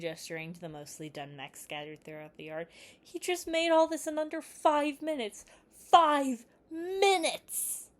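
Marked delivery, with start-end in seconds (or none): 3.0-7.9 high, loud, slow whining complaint; whining protest. A narrator's reading. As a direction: whine it like a kid pleading his case. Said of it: "he whined".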